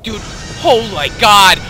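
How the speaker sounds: ordinary talk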